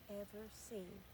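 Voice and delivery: usual speaking voice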